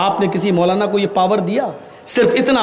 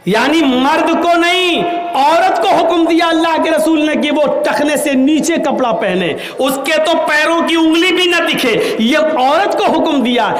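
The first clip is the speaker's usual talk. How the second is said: emphatic preaching; 8 semitones higher than usual. Acted. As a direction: press the point hard.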